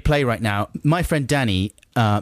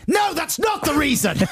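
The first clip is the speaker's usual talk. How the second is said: indignant shout. Acted. Indignant denial.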